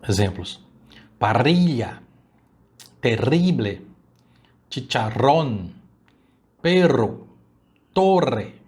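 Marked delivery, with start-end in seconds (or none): 1.2-8.5 "Parrilla", "perro" and "torre" with a rolled double R; rolled RR, rising on "perro" and "torre"; Spanish double R. Shown as a demonstration. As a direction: trill the RR in "terrible" and "chicharrón". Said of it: "conhecido como doble R".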